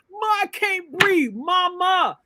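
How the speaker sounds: high-pitched acted cry for mama; voicing a son pleading for air; dying son's plea. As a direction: call out to your mother, pitched high. Acts dying son calling his mother.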